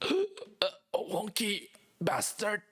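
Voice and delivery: gasp, then slow strained last words; slow dying villain's final curse. Acted. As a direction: choke out a dying insult.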